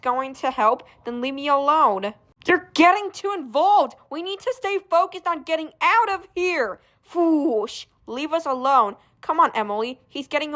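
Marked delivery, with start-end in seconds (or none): none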